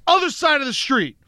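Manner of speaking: high, insistent command; mock ordering someone away; bossy retold order. Man retelling his order to a follower, high and insistent.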